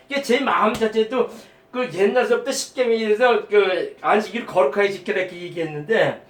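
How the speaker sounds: ordinary talk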